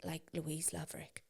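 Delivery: hushed gossiping whisper. Real, not acted. Gossiping to her friend about someone, whispered. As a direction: whisper the name so nobody hears.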